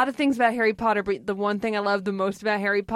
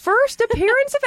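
high, loud announcer read; 5 dB louder than usual. Pretend. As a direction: announce it like a show title. Announcer-style title read.

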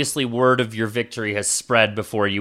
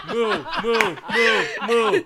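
crowd mooing, laughing; 9 semitones higher than usual. Acted. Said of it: "As you hear".

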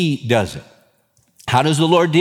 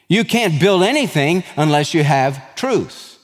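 ordinary talk, nothing marked

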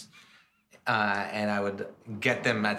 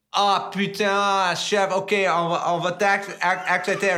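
exasperated French chef; 10 semitones higher, 8 dB louder than usual. Retold or imitated. French chef finally giving in on the phone, high, loud exasperated French. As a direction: sigh out the French like a fed-up chef. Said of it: "and he goes".

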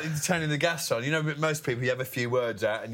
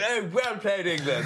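lightly voiced genteel cricket spectator, pitched up; politely applaud from the stand, a little high; quaint polite applauder; 6 semitones higher, slower than usual. Commentator mimics his usually quaint home crowd, lightly voiced politeness. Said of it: "normally, you know, the most quaint".

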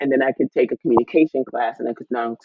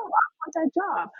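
higher, slower, dreamy "I want that job"; eager wanting; 7 dB quieter than usual. Retold or imitated. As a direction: savor the wish, pitched up and soft. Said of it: "I'm like".

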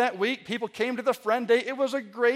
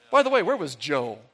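mock gossip; 6 semitones lower than usual. Mocking gossiping churchgoer.

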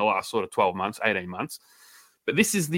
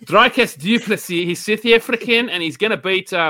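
put-on South African accent; 6 semitones higher, 3 dB louder than usual. Host hypes a South African fighter in his accent. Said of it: "it's very hard accent".

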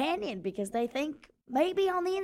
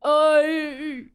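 mock sobbing; 5 dB louder than usual. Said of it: "sobbing noises".

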